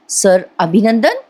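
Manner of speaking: slow repeat with a questioning rise; bewildered, drawn-out question; puzzled echo. Acted. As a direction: echo it slowly, not understanding. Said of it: "Parth थोडासा गडबडतो".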